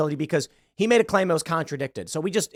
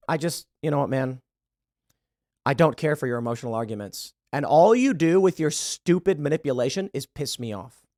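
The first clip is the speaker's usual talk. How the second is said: usual speaking voice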